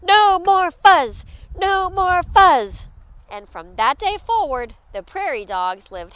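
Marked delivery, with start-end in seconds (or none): 0.0-2.9 high, loud crowd chant; emphatic group slogan; yelling. A narrator's reading. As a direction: chant it firmly like a rally. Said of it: "the crowd yelled".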